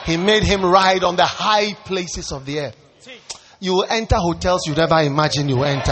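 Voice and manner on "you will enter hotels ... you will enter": normal talking voice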